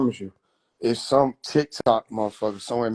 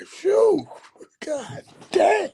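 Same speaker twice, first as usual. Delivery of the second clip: much higher put-on voice, a pained sigh; relieved Shroom; much slower than usual. Shroom relieved someone picks weed instead, high voice. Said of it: "Shroom love to see you pick up some weed".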